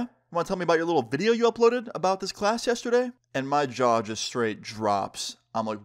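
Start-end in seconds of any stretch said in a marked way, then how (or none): 0.0-3.1 higher-pitched accusing question; mock interrogation in a raised pitch; confronting teacher. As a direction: lightly imitate a teacher calling someone out. Teacher confronts student about video.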